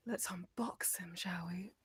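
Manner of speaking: whisper. Real, not acted. Conspiratorial unboxing invite.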